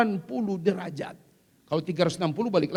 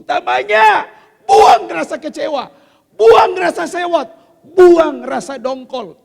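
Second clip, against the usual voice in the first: high, loud, hammering each buang; loud exhortation; 8 semitones higher, 15 dB louder than usual. Acted. Preacher commanding mothers to let go of resentment. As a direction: drive each command home.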